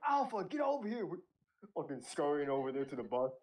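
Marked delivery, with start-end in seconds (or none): 0.1-1.1 higher barked command; drill sergeant. Retold or imitated. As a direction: call the squad like a drill sergeant. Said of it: "They're like".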